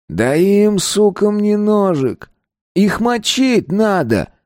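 plain reading voice

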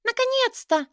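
very high, squeaky little-carpet voice; overjoyed. A narrator's reading. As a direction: burst out with high-pitched relief. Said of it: "обрадовался коврик".

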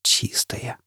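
unvoiced whisper of "Чистые". Foyle repeats it to the mirror in a whisper. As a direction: barely breathe the word. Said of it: "прошептал Фойл".

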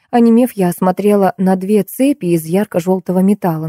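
plain reading voice

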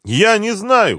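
high, loud, despairing; anguished shout, pitched far up; desperate cry. A narrator's reading. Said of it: "крикнула в отчаянии Света".

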